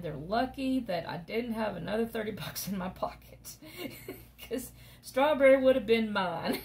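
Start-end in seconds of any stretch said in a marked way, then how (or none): 4.0-6.7 laughing. Real, not acted. Laughing confession about the puppy.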